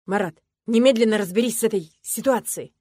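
the coach orders Marat to fix it; angry coach. Acted. Loud angry order.

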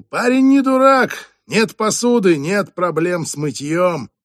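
high, loud, slow mocking quip; jeering joke. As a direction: rib the kid for the whole room. Diner jeers at clumsy waiter.

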